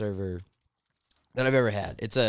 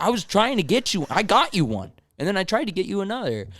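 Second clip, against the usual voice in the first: exasperated; 9 semitones higher, 3 dB louder than usual. Retold or imitated. Server defending himself heatedly.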